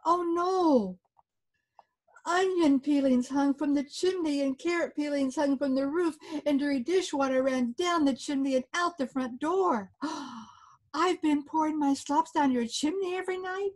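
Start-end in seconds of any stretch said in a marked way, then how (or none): 0.0-1.0 high dismayed oh no. Acted. Dismayed discovery. 10.0-10.9 quiet shocked gasp; a small horrified intake; soft gasp. Acted. Shocked discovery. 10.9-13.7 high stunned question; horrified realisation; dismayed question. Acted. Old man shocked.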